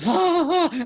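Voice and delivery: high, loud forced laugh; ha ha ha at a joke you didn't get; fake laugh. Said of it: "And you're like".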